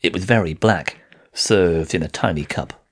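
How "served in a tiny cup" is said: ordinary delivery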